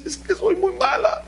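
put-on tearful child. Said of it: "Hija, ¿qué tienes?".

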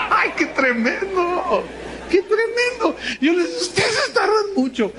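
high, laughing through the words; real laughter. Real, not acted. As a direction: speak while still laughing. Laughs at own story.

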